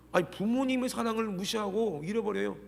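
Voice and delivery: normal talking voice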